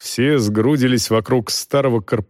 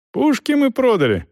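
high-pitched shout from the crowd; calling out; 12 semitones higher than usual. A narrator's reading. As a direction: call it out to him over others. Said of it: "крикнул ему кто-то".

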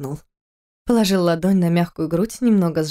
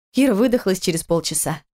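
plain reading voice

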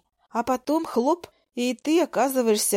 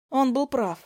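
ordinary delivery

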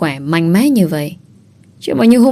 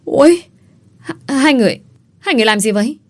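crying out in alarm; 7 semitones higher, faster than usual. A narrator's reading. Onlookers catch the pair together, crying out in alarm. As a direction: exclaim it, pitch jumping up, then stammer. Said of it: "liền la lên om sòm".

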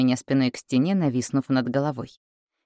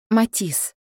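higher, urgent scolding of a name; hissed reproach; 7 semitones higher than usual. A narrator's reading. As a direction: rebuke him urgently. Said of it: "зашипела я". (возмущённо, с упрёком)

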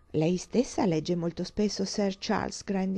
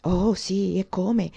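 ordinary delivery

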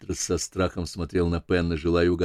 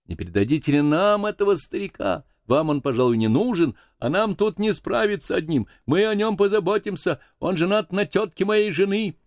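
high-pitched sailor's hail; voice from schooner; 12 semitones higher than usual. Acted. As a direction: call across to the other crew, voice raised. (высокий голос моряка со шхуны)

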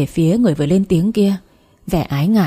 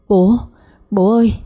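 bursting into tears; 3 semitones higher than usual. A narrator's reading. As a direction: cry out to her father, breaking down. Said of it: "òa khóc".

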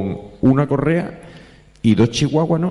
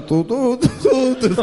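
high sung trumpet toots; mock cornet fanfare. The father's hired band blaring under the debtor's window, mock cornets.

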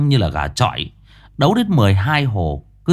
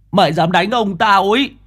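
put-on angry child yell; 9 semitones higher than usual. Boy yells at attacker.

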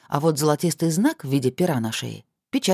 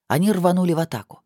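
ordinary delivery, nothing marked

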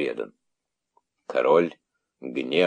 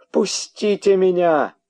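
very high, drawn-out, loud cry; frantic scream; 13 semitones higher, 5 dB louder, slower than usual. A narrator's reading. Widow fighting to reach the traitor, frantic scream. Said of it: "раздался истошный крик вдовы задумавшегося".

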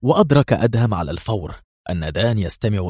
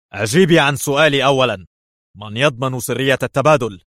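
raised, louder irritated demand; feigned agitation; 5 semitones higher, 5 dB louder than usual. A narrator's reading. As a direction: act edgy and demand an answer. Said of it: "قال في عصبية أجاد افتعالها".

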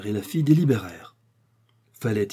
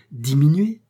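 incredulous; 4 semitones higher, 6 dB louder than usual. Acted. Porter disbelieving, asking again.

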